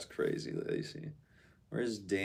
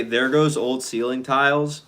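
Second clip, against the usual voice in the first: loud, raised call down the street; mock calling out; 4 semitones higher, 11 dB louder than usual. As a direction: call it out loud, pointing someone out.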